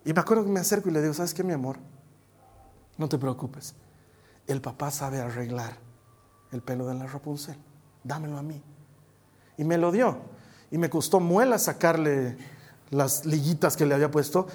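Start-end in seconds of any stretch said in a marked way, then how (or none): 1.1-8.6 soft, low, soothing father; tender reassurance. Retold or imitated. Father comforting his crying little daughter, tender reassurance. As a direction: comfort gently, with pauses.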